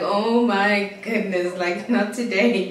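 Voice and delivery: loud, drawn-out exasperation; exasperated. Retold or imitated. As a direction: drag the words out, fed up. Exasperated at sulking boyfriend.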